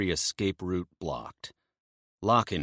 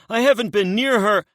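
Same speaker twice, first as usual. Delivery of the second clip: panicked denial; 10 dB louder than usual. Acted. Locken denying in panic.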